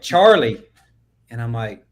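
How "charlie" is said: higher, louder mock-British "Charlie"; mocking British accent. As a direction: imitate commenters hearing "Charlie" as British. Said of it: "And they're like".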